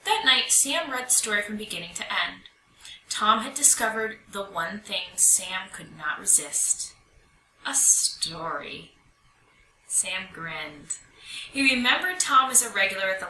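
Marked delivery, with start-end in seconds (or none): none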